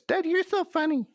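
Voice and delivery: put-on high girlish daughter voice; a sweet little girl teasing Dad. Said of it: "That's what I always say to my daughter".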